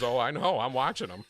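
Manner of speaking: Southern woman voice, high and loud; old beach woman answering, Southern accent. Retold or imitated. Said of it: "She goes".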